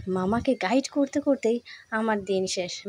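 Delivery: usual speaking voice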